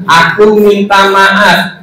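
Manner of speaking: very slow "Aku minta maaf"; teacher's drawn-out "Aku minta maaf"; model apology. Shown as a demonstration. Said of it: "saya bilang".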